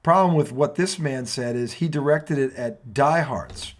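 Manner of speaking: slow, deliberate build into a rant; rant opener. Acted. Host opens his tonight rant segment, slow and deliberate. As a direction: set up the rant, taking time.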